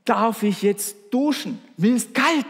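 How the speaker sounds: put-on high, pleading little boy asking to leave; shivering child's plea. Boy on the starting block begging his mother, high and pleading. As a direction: beg your mother to let you go, a child's high voice. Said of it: "bat er zitternd".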